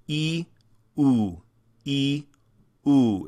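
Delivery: E then oo, tongue lifting and lowering in turn; alternating E and oo. Shown as a demonstration. Teacher drilling front and back vowels, switching E and oo. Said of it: "Experiment with that".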